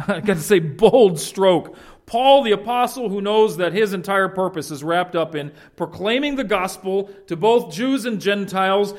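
ordinary talk

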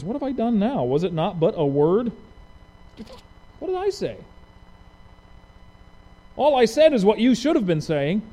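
what-did-I-do protest, rising high; mild mock outrage; indignant innocence. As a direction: protest like a wronged kid.

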